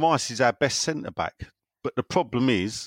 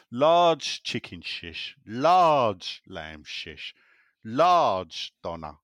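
drawn out slowly, savouring each item; savoured list; much slower than usual. Real, not acted. Host relishes his big kebab order, slowly. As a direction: linger on each kebab lovingly. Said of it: "savouring kebab order".

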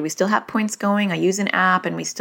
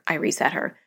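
ordinary delivery

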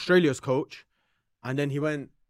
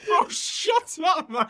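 very high, drawn-out squeal; laughing protest; much slower than usual. Real, not acted. Telling a friend to drop the bit, laughing protest. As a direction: beg him to stop while cracking up.

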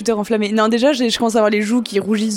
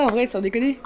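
normal talking voice

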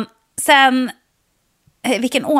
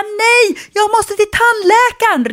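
much higher, louder frantic snap; panicked shout; 11 semitones higher, 4 dB louder, slower than usual. Retold or imitated. To the kind old man behind her, frantic high retort. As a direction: cut him off in a panic.

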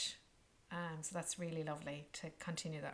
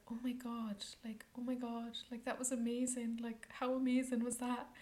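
raised, breathless gush of wonder; amazed gushing; 5 semitones higher than usual. Retold or imitated. Traveler recalling the trip, gushing in excitement. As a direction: relive the rush of amazement.

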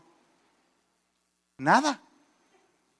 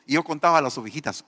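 ordinary talk

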